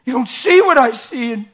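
high, loud, slow tearful pleading; rising into a cry; son breaking down. Retold or imitated. Son crying to his dad, high and tearful.

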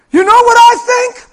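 high, loud, rousing testimony; excited shout. Retold or imitated. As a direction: shout it out like a fired-up believer. Porch woman testifies, shouting.

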